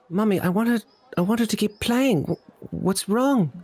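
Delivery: put-on very high small-child voice; little boy. Little boy asks his mommy about the music, small child's voice. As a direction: ask it as a high-voiced, puzzled little kid. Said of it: "one little boy turns to his mommy and says".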